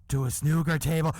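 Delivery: loud, punched punchline with rising end; scandalised reveal. Retold or imitated. Man on a bus reveals the awful detail, loud scandalised reveal. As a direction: drop the shocking detail like a bombshell. Gossiper lands the shocking detail.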